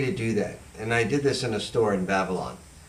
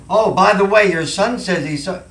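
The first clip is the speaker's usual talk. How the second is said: loud, high, tactless blurt; 8 semitones higher, 8 dB louder than usual. Shown as a demonstration. Showing the caller the wrong way, a loud blurt. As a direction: blurt it with no warm-up. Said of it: "You don't come out and say".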